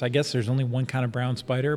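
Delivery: ordinary talk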